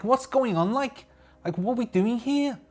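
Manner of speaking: high-pitched amateur Scouse; rough Scouse impression, high and querulous; Liverpudlian accent. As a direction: put on a Liverpudlian voice, pitched well up. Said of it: "I can't do the Liverpudlian accent".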